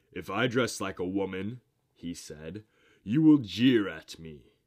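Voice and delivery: loud, indignant objection; angry protest. A narrator's reading. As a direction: bark it out, offended at the idea. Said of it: "Thor frowned angrily".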